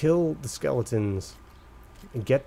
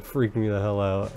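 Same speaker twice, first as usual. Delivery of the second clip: ordinary talk, nothing marked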